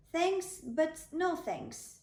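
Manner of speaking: drawn-out thanks but no thanks; slow polite refusal. Acted. David handing back the heavy armour, slow and polite. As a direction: turn it down slowly and politely. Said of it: "said David".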